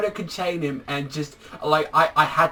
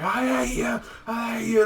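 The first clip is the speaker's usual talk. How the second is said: much higher, screamed imitation; screaming; 9 semitones higher than usual. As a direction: scream it at him, imitating the hero. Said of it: "he's just screaming".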